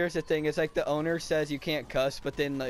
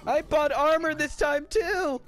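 high, loud gamer complaint; exasperated yell; 13 semitones higher, 5 dB louder, slower than usual. Real, not acted. Gamer to teammates after buying armor, real exasperated yell. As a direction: cry out in high-pitched protest. Dismay at dying despite armor.

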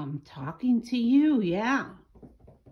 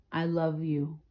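soft, lower loving voice; tender; 5 semitones lower, 5 dB quieter than usual. Real, not acted. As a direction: murmur it to your pet. Tender words to the pet.

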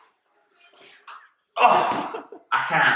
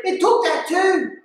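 high, loud, slow complaint; retold upset protest; 5 dB louder than usual. Diver to friends about the stolen sock, high loud complaint. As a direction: wail it back at them.

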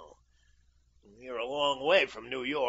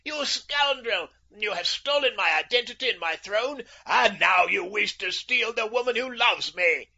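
loud, high-pitched furious tirade; screamed outrage; 9 semitones higher, 8 dB louder than usual. A narrator's reading. Livid king accusing the impostor of theft, screaming. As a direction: rage at him at the top of your voice. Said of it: "he screamed".

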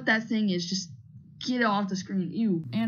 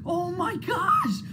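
high, stretched-out squeal of disbelief; voice-cracking excitement; 7 semitones higher, much slower than usual. Real, not acted. Streamer reacting to a rare card, voice-cracking excitement. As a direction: cry out till the voice cracks. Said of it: "that was a voice crack".

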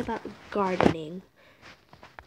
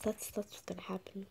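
whisper; 11 dB quieter than usual. Real, not acted. Fears getting lost in cave.

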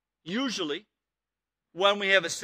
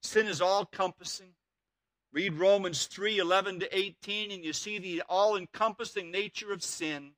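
usual speaking voice

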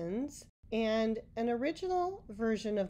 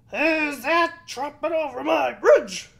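loud, higher troll roar; 6 semitones higher, 12 dB louder than usual. Acted. Troll challenges the goat on his bridge, roaring loud. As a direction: bellow it out like an angry troll. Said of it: "roared the troll".

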